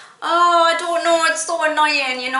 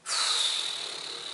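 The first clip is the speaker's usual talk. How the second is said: long breathy sigh; wordless exhale of despair; 11 dB quieter than usual. Real, not acted. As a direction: let out a long, defeated breath. Despairing at the mispronunciation.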